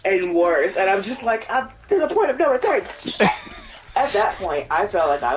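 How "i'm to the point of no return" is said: high-pitched, worked-up wail of words; hammed-up panic, pitch way up; mock desperation. Gamer retells getting worse and worse in the game, high mock desperation. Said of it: "it's just getting worse and worse".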